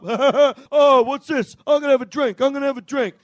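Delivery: loud, high mock delight; hammed-up discovery of a bottle; imitating a loud stranger grabbing a drink; brash guy finding a drink. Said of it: "going like this".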